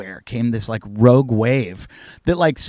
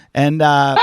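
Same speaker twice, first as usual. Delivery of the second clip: normal talking voice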